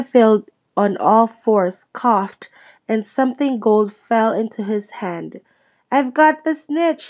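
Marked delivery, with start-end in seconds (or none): 5.9-7.1 raised, higher shout of triumph. A narrator's reading. Harry shouting to the crowd, raised triumphant voice. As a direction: call it out, holding up the prize. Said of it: "he shouted".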